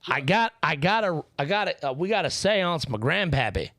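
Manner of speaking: hammed-up country hillbilly twang; hillbilly accent. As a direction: play a backwoods hillbilly yokel. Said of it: "Fucking hillbilly seance over here".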